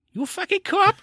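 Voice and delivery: high, put-on English accent; squeaky mock English copper. Co-host echoing the film line in a high mock English accent. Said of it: "This is my English accent now".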